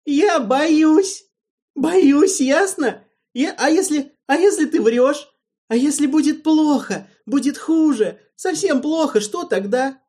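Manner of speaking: very high, loud sobbing yell; childish frightened outcry. A narrator's reading. Alvin shouts his fear at Erald with childish hurt. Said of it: "выкрикнул с детской обидой, тоской и злобой".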